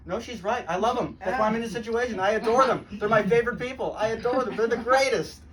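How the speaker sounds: usual speaking voice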